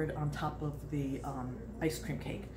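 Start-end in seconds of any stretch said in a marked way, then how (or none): none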